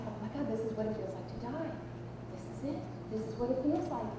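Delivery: very soft inner voice; hushed realization. Retold or imitated. Private thought while facing death.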